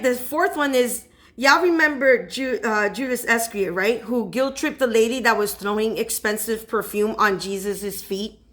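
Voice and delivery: ordinary delivery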